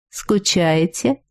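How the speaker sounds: slow low purred question; breathy vintage purr. Acted. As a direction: purr it out, trying hard to sound seductive. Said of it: "Старательно промурлыкала я". (протяжное мурлыканье, старательно соблазнительно)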